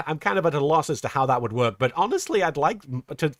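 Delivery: normal talking voice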